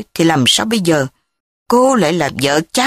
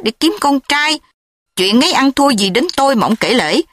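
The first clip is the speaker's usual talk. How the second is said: higher, heated rebuke; angry; 6 semitones higher than usual. Acted. Thúy angrily rebukes Châm for his request, higher heated voice. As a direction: scold him for his rude words. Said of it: "Tức giận, Thúy nói".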